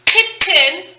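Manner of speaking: "kitten" split clearly into kit-ten; two syllables shown. Shown as a demonstration. Teacher sounding out "kitten" for students, syllables clear. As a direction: say "kitten" with both syllables distinct, rising. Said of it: "but if you listen".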